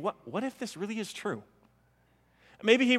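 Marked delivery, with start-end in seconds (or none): 0.0-1.4 much softer, as if thinking aloud; hushed wondering. Retold or imitated. Thomas reminding himself of what happened, hushed wondering. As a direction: voice a private dawning thought very softly.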